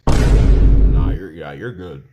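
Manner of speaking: low, offhand reassurance; casual low-voiced paramedic impression; dismissive ambulance guy. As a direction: brush it off in a low voice. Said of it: "Bro looked at me like this".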